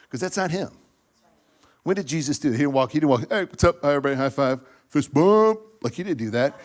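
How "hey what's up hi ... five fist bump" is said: loud, jumping high on the last words; mock hype greeting. Joking that Jesus never walked by high-fiving, loud mock hype. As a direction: boom out hellos like working a crowd.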